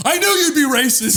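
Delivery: a whiny son, cracking up; high put-on voice with a laugh; mock son voice. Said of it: "the son's like".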